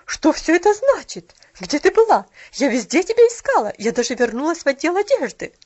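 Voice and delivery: loud, severe demand; stern scolding. A narrator's reading. Mrs Carew scolding Pollyanna for vanishing, stern. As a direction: scold the child.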